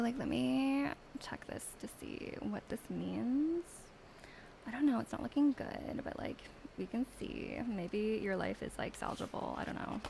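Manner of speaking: lightly voiced Valley Girl, dismissive like and ugh; a hint of a snotty Valley Girl; mean Valley Girl. Mean Valley Girl accent reading a viewer's cards. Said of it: "a mean Tara reading in a Valley Girl accent".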